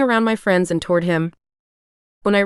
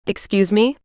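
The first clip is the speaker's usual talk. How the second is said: shocked "Excuse me?" pitched up; shocked rising excuse me. A narrator's reading. Narrator voices the shocked woman's rising excuse me. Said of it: "I said, shocked".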